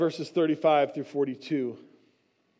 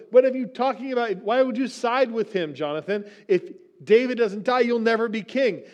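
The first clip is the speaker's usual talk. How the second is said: higher, louder, fast berating; angry rebuke; 7 semitones higher, 4 dB louder than usual. Retold or imitated. Saul rebukes Jonathan for siding with David, angry and fast. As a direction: snap at the son who sided with David.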